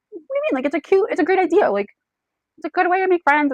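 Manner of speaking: high, taken-aback protest; acted defensive disbelief. Guest defends the dog party to a scoffing man, mildly indignant.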